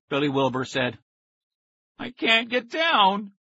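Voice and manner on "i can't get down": groan. A narrator's reading. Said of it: "his brother groaned".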